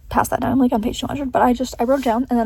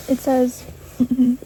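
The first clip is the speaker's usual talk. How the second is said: usual speaking voice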